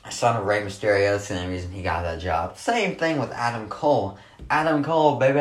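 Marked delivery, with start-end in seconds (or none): 4.5-5.4 wrestler's catchphrase. Retold or imitated. Wrestling fan mimicking Adam Cole, mock showy call.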